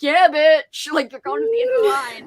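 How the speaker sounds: sassy mock gloat. Taunting the lady sent to the back of the line, sassy gloat. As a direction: gloat at the lady with a teary edge. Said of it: "I was like".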